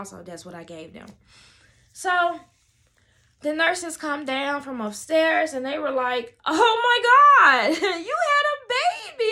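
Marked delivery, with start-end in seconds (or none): 6.4-9.3 high, loud, drawn-out amazement; astonished mock shriek. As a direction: imitate nurses screaming in delighted shock. Nurses react to the birth.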